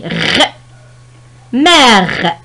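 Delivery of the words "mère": "Mère" with a long, open è. Shown as a demonstration. Teacher showing the grave accent, "Mère" with drawn-out open è. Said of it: "Accent grave sur le E".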